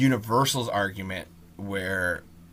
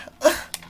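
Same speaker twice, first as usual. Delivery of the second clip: exasperated sigh. Real, not acted. Exasperated after outburst.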